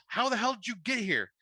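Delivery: an understated read of a screamed question; mild narrated scream. A narrator's reading. Beth to the boyfriend suddenly in her bedroom, mildly screamed. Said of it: "Beth screams".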